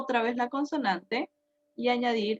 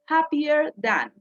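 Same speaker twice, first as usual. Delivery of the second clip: happier than said slowly and clearly; slow model phrase; 3 semitones higher, slower than usual. Shown as a demonstration. Teacher models happier than slowly for students. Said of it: "Give me an example with".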